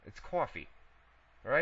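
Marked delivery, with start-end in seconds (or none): 0.2-0.7 COFFEE said cwawfee, with a w glide; accented coffee. Shown as a demonstration. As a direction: round the vowel in coffee to waw. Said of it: "say coffee and ass. Your accent is great".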